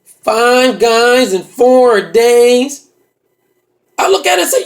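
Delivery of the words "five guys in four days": very slow, loud, stretched-out words; brother's put-on voice. Brother tallying for his sister, very slow loud put-on voice. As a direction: drag every word out loudly as the brother. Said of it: "Then he says".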